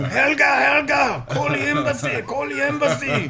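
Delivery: high put-on foreign voice, shouted; yelling as a foreign tourist. Backpacker yelling at the arresting coppers, high mock-foreign shout. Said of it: "I'm saying".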